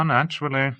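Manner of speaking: naturally said airily and high; Hawke, breezy. Acted. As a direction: toss the word off lightly.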